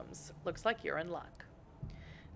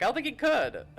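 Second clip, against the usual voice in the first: put-on voice; 8 semitones higher, 3 dB louder than usual. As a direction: speak in a high put-on voice. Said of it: "It's just a voice".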